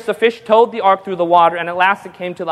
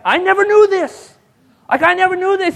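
much higher amazed voice; 10 semitones higher than usual. Retold or imitated. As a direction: play the stunned father. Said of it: "He's like".

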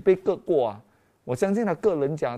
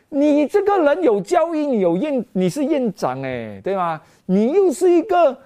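higher, louder mocking accusation from the devil; accusing devil; 4 semitones higher, 4 dB louder than usual. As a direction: taunt him like the devil scolding a fool. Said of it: "魔鬼哦就来攻击我了".

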